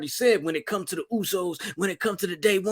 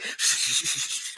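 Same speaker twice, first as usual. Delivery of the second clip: whispered shushing; soothing a hurt cousin, whispered; hushing; 5 semitones lower, 6 dB quieter, slower than usual. Retold or imitated. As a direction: hush a crying little brother. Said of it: "that's when it's like".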